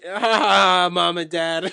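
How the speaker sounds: hammed-up gloating, high and loud; mocking Owen. Podcaster mocks Owen's gloating promo line. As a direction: crow it like the villain, mocking.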